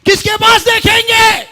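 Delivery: high, loud, fast preaching question; shouted challenge. Real, not acted. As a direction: demand an answer at full voice.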